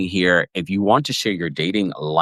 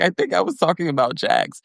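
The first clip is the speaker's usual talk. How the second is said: much higher, airy imitation of a flustered mom; mock Sutton; 10 semitones higher than usual. Retelling Sutton forgetting which kid she meant, much higher. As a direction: recall it with a high, innocent lilt. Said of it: "she's like".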